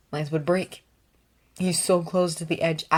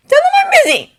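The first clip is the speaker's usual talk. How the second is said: shouting; 11 dB louder, much faster than usual. Acted. Lance hiding, shouting from tub.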